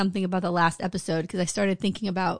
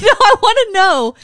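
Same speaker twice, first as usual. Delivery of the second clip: louder, higher, laughing through the words; mock outraged demand; 5 semitones higher, 8 dB louder than usual. Wife demanding to know, mock outrage. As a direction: demand an answer, half laughing. Retold demand to husband, heated.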